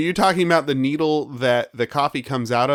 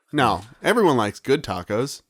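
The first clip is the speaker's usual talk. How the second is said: ordinary delivery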